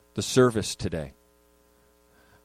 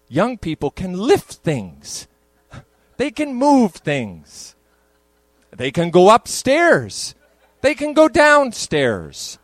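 mock wonder; 5 semitones higher, 6 dB louder, slower than usual. Real, not acted. Mocking what youth can do.

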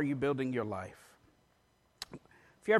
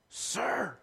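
raised pitch, softer, straining through a sore throat; mock whisper of a man losing his voice; laryngitis stage whisper; 5 semitones higher, 8 dB quieter than usual. Sick road worker to a driver, strained stage whisper. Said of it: "he whispers".

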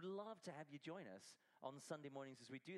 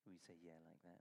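softer; 8 semitones lower, 8 dB quieter, faster than usual. Shown as a demonstration. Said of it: "You can say".

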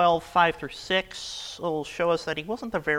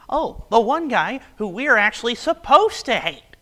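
higher and louder, a hammed-up smug dismissal; mock churchgoer thought; 10 semitones higher, 9 dB louder than usual. Pastor mocking how churchgoers think of Judas, in a higher, louder voice. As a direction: play the lazy reader who writes Judas off without a thought. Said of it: "hear the name Judas and think".

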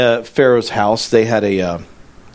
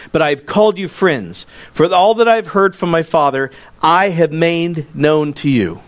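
raised, measured preaching read; scripture reading; 4 semitones higher than usual. Acted. Reads scripture verses aloud.

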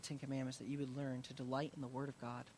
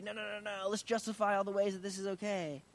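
higher-pitched imitation of pushing back; mock defensive protest; 6 semitones higher, 4 dB louder than usual. Preacher imitating someone justifying sin, mock defensive protest. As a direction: protest quickly as if excusing yourself.

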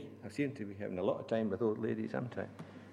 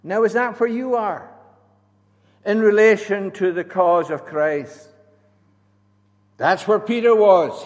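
preaching; 9 semitones higher, 14 dB louder than usual. Acted. Preaching at full force.

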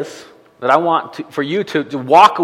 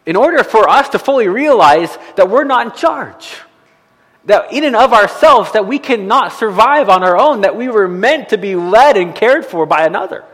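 higher, louder preaching build; 6 semitones higher, 4 dB louder than usual. Acted. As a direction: drive the point home from the pulpit.

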